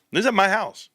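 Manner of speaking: ordinary talk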